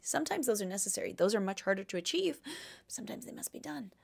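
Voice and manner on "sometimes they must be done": a grave little whisper. Acted. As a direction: drop to a whisper like a secret truth. Grave advice, hushed.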